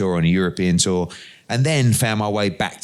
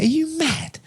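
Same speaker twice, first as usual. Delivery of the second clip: are you mad squeaked far higher in disbelief; incredulous; slower than usual. Retold or imitated. Father hearing his son's plan for the boxer, shrill disbelief. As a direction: play the dad's shocked disbelief. Dad reacting to wild plan.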